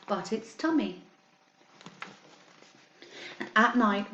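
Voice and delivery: put-on reading a picture book to children, steady and clear; story time reading. A narrator's reading. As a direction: read it like story time for a class. Teacher reads picture book aloud.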